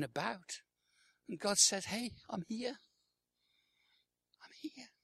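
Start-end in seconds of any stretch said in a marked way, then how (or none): 1.8-4.9 high, hushed voice, fading to a whisper; small, high, overlooked voice; God softly calling. Acted. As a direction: call very softly for attention.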